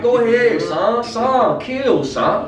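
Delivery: high-pitched mimicry of Maryland street talk; exaggerated Maryland accent, teasing; Maryland slang imitation.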